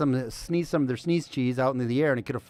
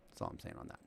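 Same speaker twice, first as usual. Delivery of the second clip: whisper; 22 dB quieter, much faster than usual. Real, not acted. Hushed sign-off of topic.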